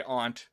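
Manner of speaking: "aunt" with a broad ah, like "ahnt"; reading pronunciation. Shown as a demonstration. Host explains reading aloud, "aunt" said as "ahnt". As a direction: say "aunt" as "ahnt", vowel open. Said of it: "if I'm reading something".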